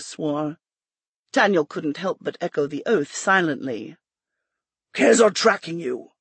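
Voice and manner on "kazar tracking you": throaty growl. A narrator's reading. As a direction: growl it from inside a helmet. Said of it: "the rider said in a throaty growl, voice echoing from his helmet".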